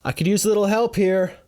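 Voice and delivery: high-pitched call for help, volume held back; shouting to crowd. A narrator's reading. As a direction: call out urgently. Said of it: "I shout to the small crowd".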